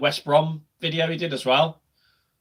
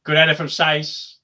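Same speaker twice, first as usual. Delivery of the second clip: normal talking voice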